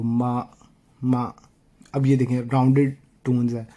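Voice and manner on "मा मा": मा dropped sharply down; falling tone. Shown as a demonstration. Teacher shows students the falling tone on मा. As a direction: snap मा down hard. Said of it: "झटका दे के गिरा देगी".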